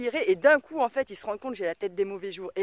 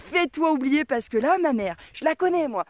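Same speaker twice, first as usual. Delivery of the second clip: put-on excited warning kid; 6 semitones higher, 5 dB louder than usual. Son warns Alain about mother.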